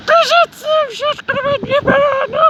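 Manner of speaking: megaphone announcement. Retold or imitated. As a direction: blare it like a platform megaphone announcer. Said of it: "bo jeszcze przez megafon".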